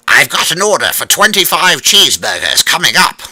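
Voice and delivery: high, loud yell from the drive-thru. A narrator's reading. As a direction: call it out over the headset.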